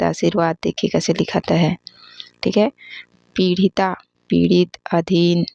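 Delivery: ordinary delivery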